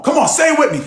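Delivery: ordinary talk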